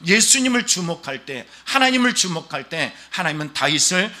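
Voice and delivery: loud, fervent sermon voice; forceful, driving pulpit delivery; impassioned preaching. Real, not acted. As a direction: press it on the congregation with urgency.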